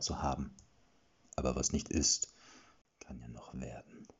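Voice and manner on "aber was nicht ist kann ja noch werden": low, softer threat fading to a whisper; menacing whisper. Acted. Najam threatens the wounded man in a menacing whisper. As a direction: let the threat die into a hiss of breath.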